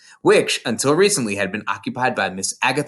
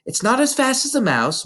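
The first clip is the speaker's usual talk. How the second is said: put-on lightly raised little girl voice; Matilda's voice; 13 semitones higher than usual. A narrator's reading. Narrator voicing young Matilda to her teacher, small girl voice. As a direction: voice a bright, earnest young girl.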